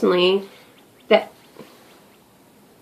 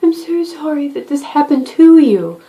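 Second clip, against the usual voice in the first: higher, slow, syrupy mock apology; fake sympathy; 7 semitones higher, slower than usual. Customer service worker to an angry caller, sugary fake sympathy. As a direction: lay the sorry on thick for the customer. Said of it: "my job is to sort of grin and bear it and say".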